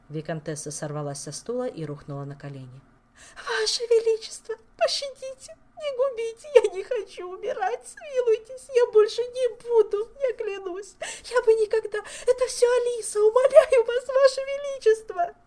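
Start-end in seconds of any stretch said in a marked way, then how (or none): 3.4-15.3 high, loud begging through tears; tearful pleading. A narrator's reading. Favourite begging the king to hear her. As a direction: beg the king to believe her.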